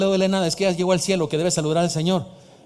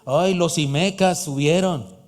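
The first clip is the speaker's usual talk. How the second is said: drawn-out mock lament; whiny complainer; slower than usual. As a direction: mimic a bitter grumbler. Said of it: "No vivas amargado pensando en".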